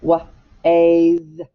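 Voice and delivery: "wa-, ays" split apart and drawn out; slow phonics stretch of "ways"; stretched syllable. Shown as a demonstration. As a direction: stretch "ways" into its separate sounds. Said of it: "Now, second syllable".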